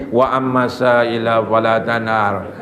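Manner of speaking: slow, drawn-out Quranic chant; Quran recitation. Acted. Preacher recites the verse to the congregation, slow Quranic chant. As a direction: intone the verse slowly.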